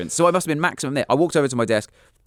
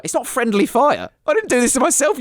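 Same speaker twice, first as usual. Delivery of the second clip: indignant protest; 3 dB louder than usual. Retold or imitated. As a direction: protest your innocence, wide-eyed. Defending himself to the teacher.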